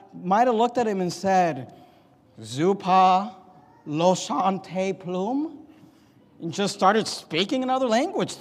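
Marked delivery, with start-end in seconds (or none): 2.4-5.6 slow, drawn-out made-up foreign words; mock foreign gibberish. Confused builder answering in an invented tongue, mock gibberish. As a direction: sound like a stranger from far away.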